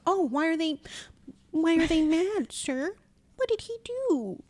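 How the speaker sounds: high, slow, wide-eyed fake innocence; playing dumb. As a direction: act clueless to draw him out. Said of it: "was just playing dumb".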